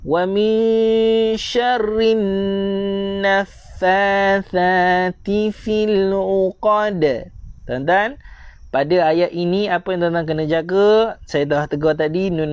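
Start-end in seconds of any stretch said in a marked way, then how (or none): 0.0-7.3 slow, higher, drawn-out tajwid recitation; Quran recitation. Shown as a demonstration. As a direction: chant each syllable slowly for students to follow. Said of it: "Sekali lagi saya baca". (bacaan tartil perlahan)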